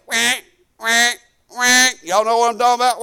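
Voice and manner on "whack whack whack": imitated squeeze-duck squawk; squawky whack noise; toy duck squeak. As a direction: mimic a rubber duck being squeezed. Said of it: "that you squeeze and they go".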